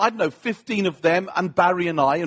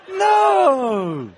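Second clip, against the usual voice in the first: mock shout; 7 semitones higher, 5 dB louder than usual. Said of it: "the way we Brits talk to foreigners".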